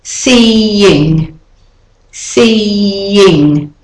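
Seeing drawn out as SEE-ying; intrusive y. Shown as a demonstration. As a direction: glide from E to I with a y in Seeing. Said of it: "an intrusive Y".

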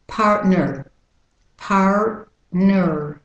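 American "partner" with both Rs sounded; American accent. Shown as a demonstration. Teacher switches partner to American accent. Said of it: "with the American accent".

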